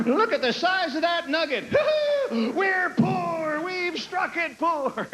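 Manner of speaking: put-on high, excited old prospector. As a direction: yell it like a prospector striking gold. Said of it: "old prospector Rick panning".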